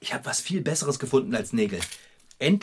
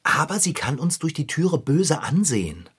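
normal talking voice

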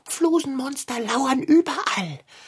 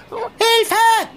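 much higher and louder frantic yells; panicked cries for help; 11 semitones higher, 4 dB louder, much slower than usual. Acted. Der Kater ruft panisch nach dem Fahrradschrauber, schreiend. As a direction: scream for rescue in comic terror. Cat sees dust monster.

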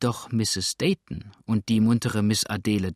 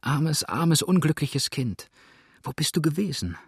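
higher, tearful pleading; a friend breaking down at a sickbed; sobbing; 5 semitones higher than usual. A narrator's reading. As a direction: plead through tears, voice lifted. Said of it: "warf sich schluchzend über sie".

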